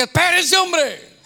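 loud, high exasperated "wait!"; irritated pastor cutting in; annoyed shout. Retold or imitated. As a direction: snap at the elders to stop. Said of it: "Entonces les dije".

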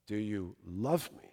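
very soft, low, intimate question; hushed searching question. Retold or imitated. The Lord questions the preacher personally, hushed searching question.

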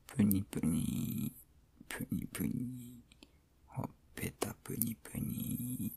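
playful soft whisper; pillow talk. Acted. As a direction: tease her in a whisper. Playful cheek poking in bed.

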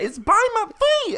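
panicked yell. Retold or imitated. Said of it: "was like".

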